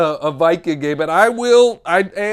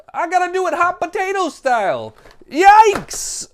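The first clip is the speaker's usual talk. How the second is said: mock alarm; 10 semitones higher than usual. Host showing cards hot potato style, mock alarm. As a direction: build to a startled yelp. Pretending pieces are too hot.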